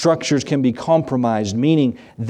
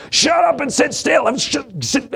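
parent's shout; 11 semitones higher than usual. Retold or imitated. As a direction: snap at the kids to sit still. Parent yelling before family photo.

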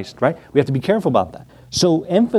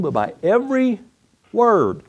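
"every" pitched high, then a pause before "word"; stress on EVERY WORD; 8 semitones higher than usual. Real, not acted. Preacher stressing key words in verse.

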